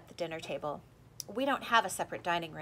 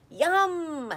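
put-on high, loud toddler yum; gleeful toddler; 10 semitones higher, 10 dB louder than usual. A narrator's reading. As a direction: savor it like a naughty little kid. Toddler relishing the flowers.

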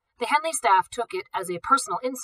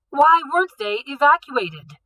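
loud, high demand; stern demand; 7 semitones higher, 7 dB louder than usual. A narrator's reading. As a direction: press the guards hard. Director questions the guards.

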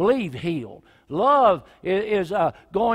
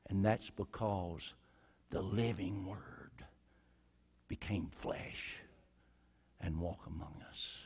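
hushed preaching; 12 semitones lower, 11 dB quieter than usual. Real, not acted. Preacher closing quietly to the congregation, hushed. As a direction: draw them in with a hush.

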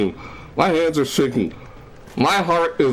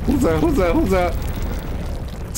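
high, frightened repeated question; panicked questioning; 7 semitones higher, slower than usual. Real, not acted. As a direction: ask over and over in rising fright. Hears someone in game.

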